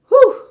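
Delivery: sudden high exhale of relief; high whistling sigh of relief; high relieved whew. Acted. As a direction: breathe out the day's stress. Relief on seeing partner.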